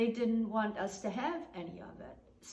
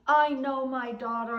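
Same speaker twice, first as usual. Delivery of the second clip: louder, higher insisting voice; 4 semitones higher, 7 dB louder than usual. Retold or imitated. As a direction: hammer it home against her. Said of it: "Ostermann kept saying".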